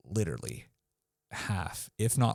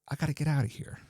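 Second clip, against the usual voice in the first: eager to leave; 7 semitones higher, faster than usual. Shown as a demonstration.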